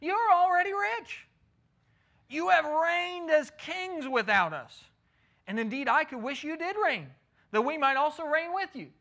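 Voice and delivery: high, loud mocking sarcasm; sarcastic. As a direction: lay the sarcasm on thick, voice up high. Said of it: "he begins to use that sarcastic language".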